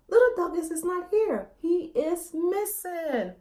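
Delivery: a frightened father announcing his son is gone; alarmed father. Acted. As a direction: voice rising with worry, then slowing on missing. Father panics in story.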